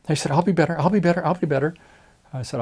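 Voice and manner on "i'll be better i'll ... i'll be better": higher, louder mock pleading; pleading promise. Rude patient promises the doctor, higher and louder pleading. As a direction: beg like a scolded patient.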